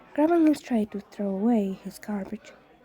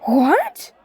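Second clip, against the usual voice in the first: a startled, higher, louder yelp of disbelief; stunned outburst, higher and louder; yelled; 6 semitones higher, 6 dB louder than usual. Acted. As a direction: blurt it out in shock. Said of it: "Izuku yelled".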